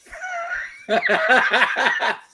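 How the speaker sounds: shrieking real laugh, high up; high laughing fit. Real, not acted. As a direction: lose it over the joke. Laughing at revenge joke.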